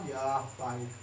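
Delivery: imitating a sulker, low and subdued; sullen minimal reply. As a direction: drop low, give only the bare answer. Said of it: "Cuma bilang begitu". (menirukan jawaban cemberut, bernada rendah)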